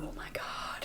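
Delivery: a hushed, breathless whisper of disbelief. Real, not acted. Hearing her own accent on old footage, whispered disbelief. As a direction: whisper it in dismay. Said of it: "And I'm editing this video".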